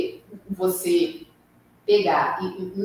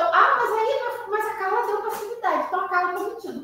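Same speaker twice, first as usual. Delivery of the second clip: higher-pitched mimicked objector, running on; mock accusation; 7 semitones higher than usual. Listener objects that Carla is lying, mock accusation. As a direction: voice an imagined accuser in a raised pitch.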